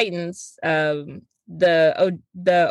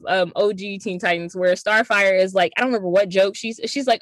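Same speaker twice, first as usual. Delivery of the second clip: normal talking voice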